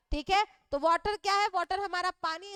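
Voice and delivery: usual speaking voice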